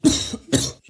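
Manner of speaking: sharp raven cough; coughing up a bug. Acted. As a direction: cough like something's stuck. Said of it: "I swallowed a bug".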